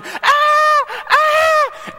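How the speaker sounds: two piercing high screams, loud. Retold or imitated. Little sister screaming at the hand through the window, piercing shriek. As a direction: scream like a startled girl. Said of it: "sister yelling".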